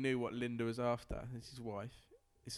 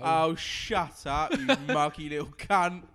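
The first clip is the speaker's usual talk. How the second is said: slow, louder laddish jeer, laughing through it; pub lout; 8 dB louder, slower than usual. Acted. Lout in the pub sneering at a stranger, mock laddish jeer. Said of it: "You imagine saying that in the pub".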